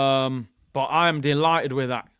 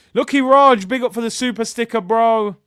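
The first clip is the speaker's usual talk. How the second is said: usual speaking voice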